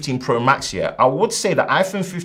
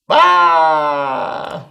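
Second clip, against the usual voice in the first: hype shout; 5 semitones higher, 4 dB louder than usual. Pretend. Shout ad-lib.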